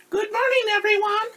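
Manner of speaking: cheery radio DJ greeting listeners; radio woman. Acted. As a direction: chirp it like a morning DJ. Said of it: "said the radio woman's voice".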